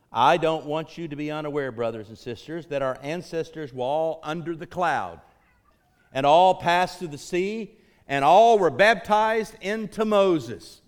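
ringing scripture proclamation, rising in power; preached scripture reading. Acted. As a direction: proclaim the verse to the congregation, building in force.

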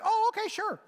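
high, loud mock barista chirp; hammed-up cheery barista voice. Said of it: "they'd be like".

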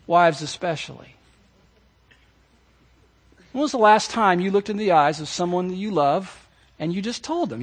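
ordinary delivery

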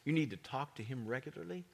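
ordinary talk

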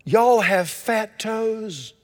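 slow, high, drawn-out exclaim; imitating her happy cry; retold joyful cry. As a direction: relive the delight of finding family. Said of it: "and I cried out".

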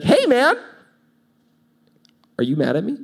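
high, worried "Hey, man" as the upset friend; anxious friend. Retold or imitated. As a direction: play the nervous buddy asking if he's mad. Acted text to friend.

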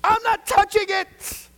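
very high, very loud yell; hammed-up shout of flat-out refusal; acted stubborn refusal. Preacher acting Peter refusing unclean food, hammed-up high yell. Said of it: "this is what he would do".

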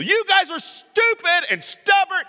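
high, loud furious yell; hammed-up angry scolding shout; yelling at the people. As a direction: Moses losing it with the crowd. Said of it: "he's yelling at the people".

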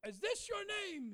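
high, slow, insistent question; prophetic calling out. Retold or imitated. Preacher confronting a bawling kid by name, high insistent call.

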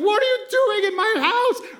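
very high, loud mock outrage; furious king. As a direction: bellow at the intruder in your house. King finds Haman on Esther.

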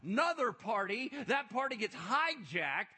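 loud, high driving sermon; preaching at full force. Acted. Preacher explaining the robe, ring and shoes, full force. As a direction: hammer home the father's welcome.

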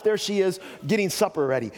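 ordinary talk

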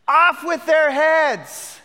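high, loud, drawn-out royal decree; commanding shout. Pretend. Preacher imagining himself in Joseph's place, commanding shout. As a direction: order an execution like a tyrant. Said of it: "if I was big man on campus".

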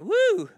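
loud, high-pitched "woo"; mock-impressed holler; high whoop. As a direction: whoop as if amazed. Exclaims at forgiving seven times.

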